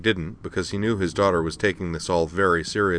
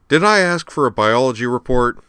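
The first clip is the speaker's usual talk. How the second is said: louder, higher, irritated retort; indignant demand; 5 semitones higher, 6 dB louder than usual. A narrator's reading. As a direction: cut the machine off with annoyance. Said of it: "Al demanded".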